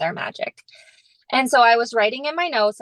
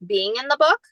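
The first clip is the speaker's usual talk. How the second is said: ordinary talk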